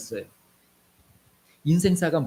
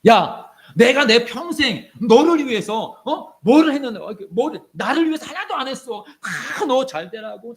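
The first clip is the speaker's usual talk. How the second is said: indignant parent; 5 semitones higher than usual. Retold or imitated. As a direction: turn the blame around in aggrieved outrage. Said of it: "오히려 부모님 화를 내요". (억울해하며 화내는 엄마 목소리)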